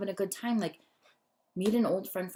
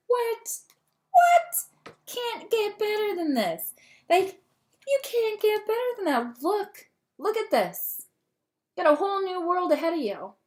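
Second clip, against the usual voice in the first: much higher and louder, disbelieving joy; real excitement; 12 semitones higher, 5 dB louder than usual. Real, not acted. Reader shrieking at the Star card for her viewer, overjoyed. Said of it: "I got all on a tangent and excited".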